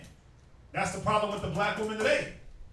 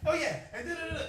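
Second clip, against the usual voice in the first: higher-pitched mock nagging wife rattling on; lightly mocking, higher voice of a scolding wife; 8 semitones higher, faster than usual. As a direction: impersonate the aunt going in on him. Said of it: "come back".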